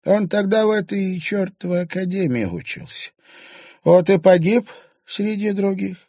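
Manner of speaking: high, loud crone turning to threat; grieving, vengeful old crone. A narrator's reading. Old woman tells the journalist of her son, high and vengeful. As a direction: mourn then curse the killers.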